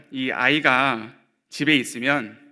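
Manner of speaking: usual speaking voice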